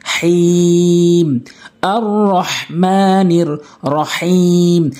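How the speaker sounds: "হাইম" held very long on one note; "রহিম" recited with a three-beat long final vowel; long pause-ending stretch. Shown as a demonstration. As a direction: draw out the vowels of "রহমানির রহিম", chanted slowly. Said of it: "তিন আলিফ টেনে".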